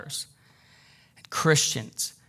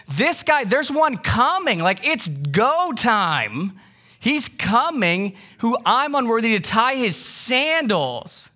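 excited prophet; 7 semitones higher, slower than usual. Retold or imitated. John announcing the Messiah.

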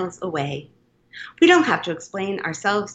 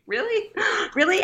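much higher, questioning "really?"; retold disbelief; 12 semitones higher than usual. Poet recalling their reaction to a spam email, mock incredulous. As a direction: imitate your own incredulous reaction.

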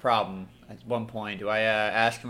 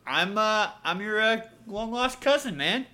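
nervous lie; 11 semitones higher, slower than usual. Acted. Player lies about being a cousin.

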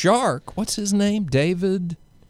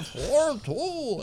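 put-on high Scottish accent for the numbers; 10 semitones higher, 5 dB quieter than usual. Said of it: "trying to say".

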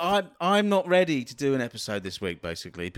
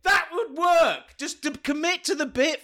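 excited; 9 semitones higher than usual. Real, not acted. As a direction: pitch it up with delight. Excited high outburst.

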